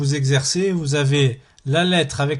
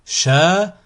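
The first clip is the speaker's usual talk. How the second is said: split into syllables; 4 semitones higher, 4 dB louder than usual. Shown as a demonstration. Teacher models a word for learners to repeat, split into syllables. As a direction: cut the word up, pausing between syllables. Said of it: "Vous découpez le mot".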